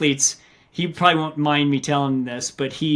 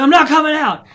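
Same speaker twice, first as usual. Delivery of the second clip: higher, louder panicky refusal; stubborn frightened refusal; 10 semitones higher, 5 dB louder than usual. Retold or imitated. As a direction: refuse like a scared kid hiding. Runner refusing to leave cave.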